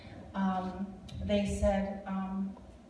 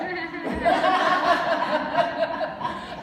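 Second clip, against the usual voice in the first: high burst of laughter; 8 semitones higher, 8 dB louder than usual. Real, not acted. Laughs after joke.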